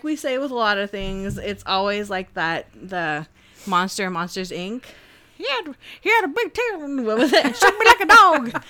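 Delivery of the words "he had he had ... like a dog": put-on loud, laughing Southern accent. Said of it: "I always love when you do a Southern accent".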